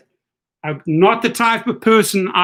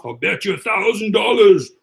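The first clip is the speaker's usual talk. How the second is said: mocking imitation. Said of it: "loud mouth".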